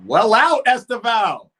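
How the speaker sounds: high, loud cheer of praise; excited shout. Real, not acted. Streamer cheering Esteval's play, excited shout. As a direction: shout praise, pitch jumping up.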